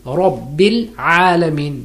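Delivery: Rabbil alamin slowly, the r of Rabbil heavy and full; full-mouth ra. Shown as a demonstration. Teacher demonstrating the heavy ra, slowly. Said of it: "will be read fully, heavily".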